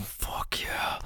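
soft breathless whisper. Acted. Lover whispering at the peak of pleasure. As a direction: let it out on the breath.

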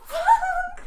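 high sharp cry of pain; pained yelp. Real, not acted.

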